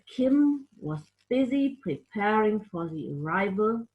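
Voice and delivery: ordinary delivery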